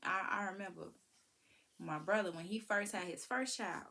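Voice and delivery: ordinary delivery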